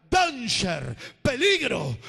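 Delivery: loud, sharp alarm; warning cry. Acted. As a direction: shout the warning out. Warning the congregation.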